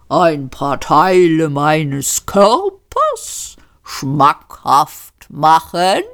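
langsam, gedehnte Rinderstimme; höfliches Rind. A narrator's reading. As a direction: ein freundliches Schlachttier, das sich anbietet. Das Tier preist sich an. (das Hauptgericht stellt sich den Gästen gemächlich vor)

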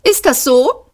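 high-pitched, dismissive little question. A narrator's reading. Insect clerk rebuffing the moping robot, dismissive. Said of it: "sagte das Insekt abweisend".

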